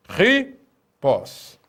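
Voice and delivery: Ripós drawn out with a strongly aspirated first sound; strong aspiration. Shown as a demonstration. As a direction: breathe a hard h into Ripós, then a separate pós. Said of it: "Isso torna a aspiração mais forte".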